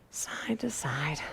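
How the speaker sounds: slow whisper. Real, not acted. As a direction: breathe the cue out softly. Cool-down stretch cue.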